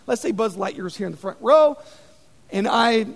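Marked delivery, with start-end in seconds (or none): none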